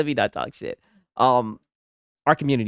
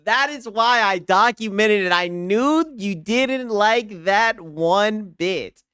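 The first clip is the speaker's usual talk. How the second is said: gloating; 7 semitones higher, slower than usual. Real, not acted. Excited gloating at Chris.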